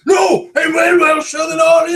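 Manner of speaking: very high, loud Welsh shout; mock Welsh. Beating all friends in a Welsh off, shouted mock Welsh. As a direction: outshout everyone in hammed-up Welsh. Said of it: "A Welsh off".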